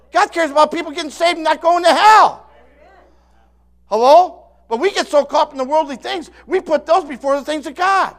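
loud, high-pitched pulpit shouting; fiery preaching. Real, not acted. Preacher shouts at the congregation about worldly things, full force. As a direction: hammer each point home to the crowd.

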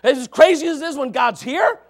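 usual speaking voice

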